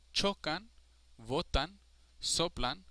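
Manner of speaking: chocan, votan, soplan with stressed first syllables; stress on second-to-last. Shown as a demonstration. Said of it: "the second to last syllable is strongest".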